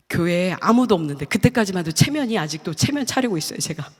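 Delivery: normal talking voice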